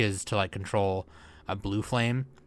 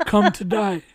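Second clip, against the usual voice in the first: villain voice; 9 semitones higher, 5 dB louder than usual. Pretend. Quoting villain line.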